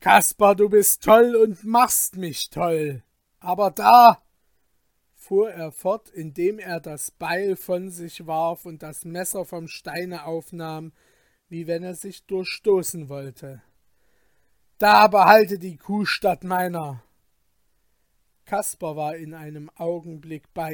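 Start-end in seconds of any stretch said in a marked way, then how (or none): 0.0-4.2 loud, exasperated shout; frenzied outburst. Acted. Falke flinging the axe away, frenzied shout. 14.8-17.0 loud, reckless cry; desperate outburst. Acted. Falke, knife at himself, to his friend, desperate shout.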